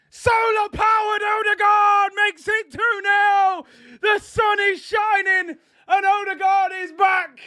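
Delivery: high, sustained jubilant shouting; real goal celebration. Real, not acted. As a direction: shout it out triumphantly, stretching the words.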